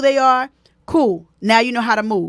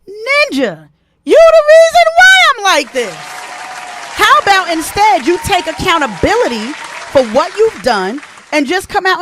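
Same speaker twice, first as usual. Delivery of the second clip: high, loud exasperated outburst; indignant outburst; 8 semitones higher, 8 dB louder than usual. Retold or imitated. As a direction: shout it back at them, fed up. Angry retort to the crier.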